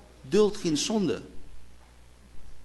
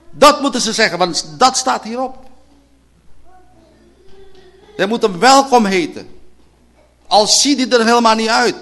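loud, raised sermon voice; fiery preaching; 7 semitones higher, 9 dB louder than usual. Acted. Preacher presses the church, loud and raised. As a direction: press it on them.

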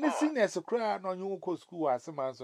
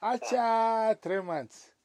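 normal talking voice

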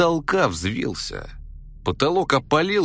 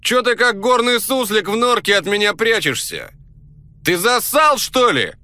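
shouting back; 9 semitones higher, 6 dB louder than usual. Retold or imitated. Borya taunts the hidden voice to come out, loud high yell. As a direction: bellow a challenge into the smoke. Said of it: "Я ему кричу в ответ".

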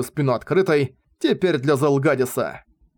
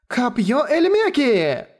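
shouted spell; 10 semitones higher, much slower than usual. Acted. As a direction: call the spell out full force. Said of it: "крикнула она".

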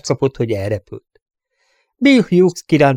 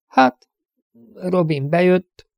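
high, slow and halting, voice wavering up; a scared girl forced to answer; frightened hesitant girl; 8 semitones higher, slower than usual. Acted. Amy answering the sheriff, scared and halting.

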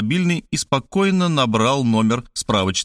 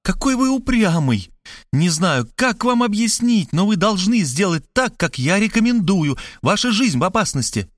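much higher, pleading in despair; desperate cry; 8 semitones higher than usual. A narrator's reading. Markhaleva desperate to convince stubborn Robert, high pleading cry. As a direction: wring your hands and plead, voice pitched up. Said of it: "заламывая руки, закричала она".

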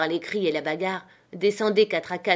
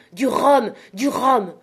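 desperate shouts; 5 semitones higher, 7 dB louder, slower than usual. A narrator's reading. Captain keeps demanding rum.

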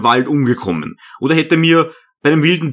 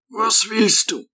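put-on higher, softer voice of an old hermit; a small old hermit answering; 9 semitones higher, 4 dB quieter than usual. A narrator's reading. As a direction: voice the little old monk gently.

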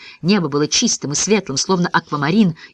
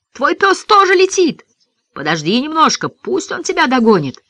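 high, excited calling; called out; 10 semitones higher, 3 dB louder than usual. A narrator's reading. Boy asking Ben to wait for his dog, excited call. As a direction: call up to someone in the air. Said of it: "крикнул ему я".

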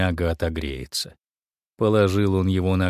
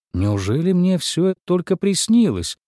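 high, amazed wondering; 9 semitones higher than usual. A narrator's reading. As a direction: marvel at it aloud. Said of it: "подумал он".

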